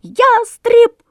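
very high, loud alarm cry; shout. Retold or imitated. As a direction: yell the warning sharply. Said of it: "как крикнет".

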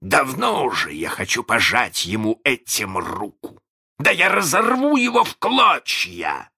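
threat building to a high, loud shout; threatening. A narrator's reading. Hook vows revenge on Peter Pan, building to a threatening shout. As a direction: start menacing, end shouting in full fury. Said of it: "он с угрозой потряс крючком".

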